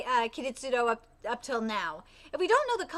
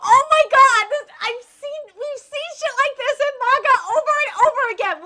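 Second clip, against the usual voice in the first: high, loud gushing; excited recognition; 7 semitones higher, 9 dB louder than usual. Real, not acted. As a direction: burst out, thrilled to recognise it. Excited recognition of manga trope.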